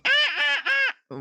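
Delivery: very high honking ah ah; mimicking an impatient driver honking, very high; imitated car horn. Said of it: "somebody honking".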